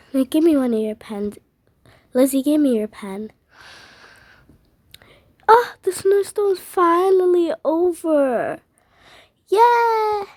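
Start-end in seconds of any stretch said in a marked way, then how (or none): 5.5-10.2 high, loud, slow relief; relieved cry. Acted. Family rejoicing as the snowstorm finally ends. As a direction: cheer through tears of relief.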